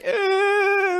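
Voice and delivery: very high whimpering wail of a hurt little brother; imitated crying wail. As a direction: cry out like a kid who just got hurt. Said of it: "his brother's like".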